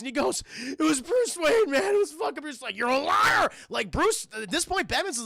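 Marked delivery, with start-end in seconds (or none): 0.6-2.6 high, loud, desperate blurting; frightened confession. Retold or imitated. Tortured man answering Batman, frightened confession. 2.8-3.5 loud, high shout of disbelief; angry accusation. Retold or imitated. Batman rejecting the man's answer, angry accusation.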